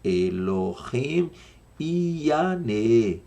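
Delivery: "Elohim" drawn out very slowly, then "Yané" lifted; slow it right down, word by word: "Elohim", "Yané"; slow teaching delivery of "Elohim Yané". Shown as a demonstration. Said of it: "Vou falar devagar".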